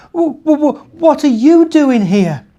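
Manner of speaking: high frightened stammer. Acted. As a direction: stutter it out scared. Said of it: "he stammered".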